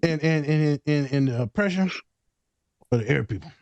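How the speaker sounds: halting, lightly put-on comic voice; funny voice. Host floundering through a joke summary, funny voice. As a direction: stumble along in a silly voice. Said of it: "You just said it in a funny voice".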